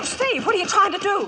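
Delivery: high frightened cry; panicked question at him; alarmed. Acted. Lola alarmed as Steve unhitches a horse.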